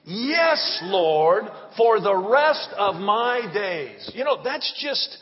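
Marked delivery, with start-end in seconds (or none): 0.0-4.1 loud, slow call to repeat after him; call to the congregation. Shown as a demonstration. Preacher leading the congregation in a response, loud and slow. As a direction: lead the church in a slow, ringing response. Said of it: "Can you say that with me?".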